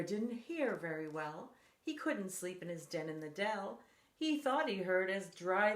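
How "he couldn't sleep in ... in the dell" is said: plain reading voice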